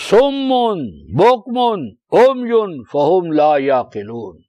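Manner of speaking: slow, measured Arabic recitation; slow chanted Quranic Arabic. Acted. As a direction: intone each word of the verse apart.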